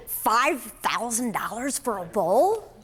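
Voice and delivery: slow, drawn-out stunned question; stunned disbelief. Retold or imitated. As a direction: let the price sink in, word by word. Stunned by five thousand dollar price.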